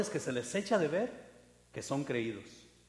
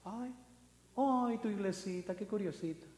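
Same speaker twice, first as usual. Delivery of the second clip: sweetly mocking, softer, a touch higher; condescending; 4 semitones higher, 4 dB quieter than usual. The proud pastor belittling a little church, mock-sweet. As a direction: talk down to a small church. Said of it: "Así como el altivo nos ve".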